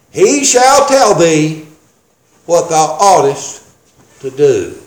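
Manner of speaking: drawn-out scripture, dropping low at the end; slow deliberate reading. Shown as a demonstration. Preacher reading the angel's words to a congregation, slow and deliberate. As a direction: read the verse very slowly, broken by pauses. Reads verse slowly for emphasis.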